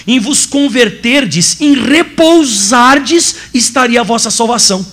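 a gently hushed preacher lingering over Isaiah; solemn scripture reading. Acted. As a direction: savour each phrase as holy words.

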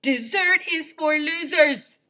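high, loud, slow stern principal; stern Doctor Carbles. Acted. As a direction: bark it out like a strict principal.

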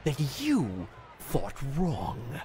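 halting, emphatic promo with big pitch swings; wrestling promo voice. Acted. Gamer mimicking a wrestling promo about the arena, theatrical. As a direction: deliver a menacing promo, slowing right down at the end.

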